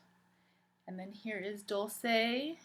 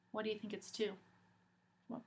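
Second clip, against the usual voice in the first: plain reading voice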